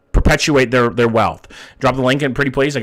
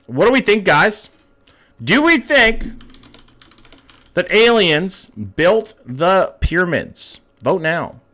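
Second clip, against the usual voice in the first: high, drawn-out game-show style question; announcer; 5 semitones higher, slower than usual. Acted. Streamer puts the alien theory to chat, mock-grand announcer. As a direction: pose it grandly, with big pauses.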